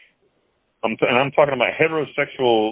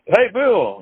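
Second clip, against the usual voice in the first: high-pitched Irish London greeting; Irish London accent; 10 semitones higher, 3 dB louder than usual. Retold or imitated. New prison friend greets the newcomer, imitated Irish London accent. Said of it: "in this Irish, Irish London accent".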